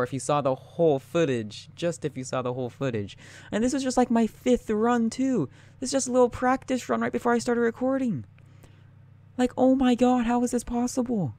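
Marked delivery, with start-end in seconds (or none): 3.5-11.3 much higher, excited squeaky talk; giddy disbelief. Real, not acted. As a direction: babble in thrilled disbelief. Disbelief at his own score.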